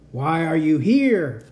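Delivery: a robot guard confronting intruders, shrill and slow; robot demanding. A narrator's reading. Robot guard confronts Cat, a slow shrill demand. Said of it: "Frank demanded".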